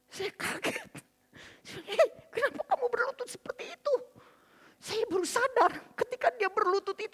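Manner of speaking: very high, crying voice; high-pitched tearful confession. Real, not acted. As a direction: relive the shock and remorse, close to sobbing. Tearful reliving of husband kneeling.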